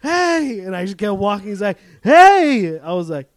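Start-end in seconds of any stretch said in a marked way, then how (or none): 0.0-0.6 much higher, loud call; security guard shouting hey; guard's shout. Retold or imitated. Guard calling out. 2.0-2.8 much higher, loud call; security guard shouting hey; guard's shout. Retold or imitated. Guard calling out.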